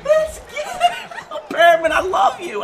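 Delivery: very high, squealing through laughter; high-pitched elated relief; overjoyed. Real, not acted. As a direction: squeal your delight, laughing. Celebrates receiver's catch.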